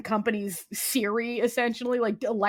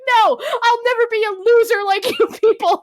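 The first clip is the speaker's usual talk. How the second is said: high, loud sneering retort; mock haughty shout; 11 semitones higher, 6 dB louder than usual. Cameo boss mocking the department, loud and high. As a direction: fling the insult as you flee.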